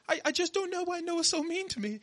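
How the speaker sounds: high-pitched mock British whine; imitated British accent. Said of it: "introduce himself to people in a British accent".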